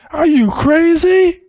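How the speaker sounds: high, drawn-out mock disbelief; mock incredulity. Teacher imagining seeing it clearly, mock incredulous. Voices a reaction to himself.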